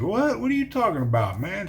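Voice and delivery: sudden high, puzzled "What?"; startled what. Retold or imitated. Man startled by a stranger's warning, surprised. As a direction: jump up in pitch, caught off guard.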